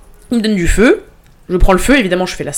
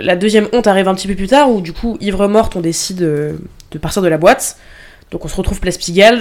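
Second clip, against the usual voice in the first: ordinary delivery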